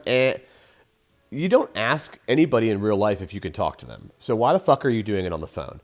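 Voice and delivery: ordinary talk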